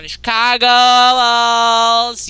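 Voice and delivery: slow, loud, stretched-out team introduction; arena announcer. Acted. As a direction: boom it out like a stadium announcer.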